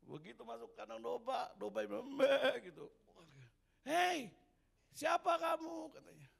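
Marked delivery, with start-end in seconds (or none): 2.1-2.7 a loud, high sheep's "meh"; sheep bleat. Acted. 3.8-5.9 high, loud challenge from a sheep; haughty sheep. Acted.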